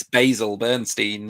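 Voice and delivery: Basil as "BAY-zil", Bernstein ending in "steen"; American Basil Bernstein. Shown as a demonstration. Teacher joking about American pronunciation, Basil Bernstein as "BAY-zil BURN-steen". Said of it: "if you're American".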